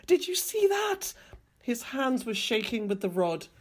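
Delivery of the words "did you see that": high-pitched, astonished cry; stammered shock. A narrator's reading. Hank asking the men if they saw her, stammered in shock. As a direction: exclaim high, in alarm. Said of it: "stammered Hank".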